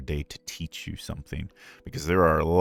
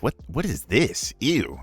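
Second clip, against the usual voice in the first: higher, slow, put-off reaction; mock disgust; 6 semitones higher, slower than usual. Viewer reacting to his live stream, mock disgust. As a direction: recoil in exaggerated distaste.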